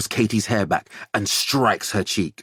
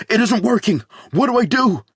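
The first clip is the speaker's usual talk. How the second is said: panicked, high and loud, voice cracking; desperate father, voice breaking; 13 semitones higher, 5 dB louder than usual. A narrator's reading. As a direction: cry out in terror for help. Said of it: "His voice breaks".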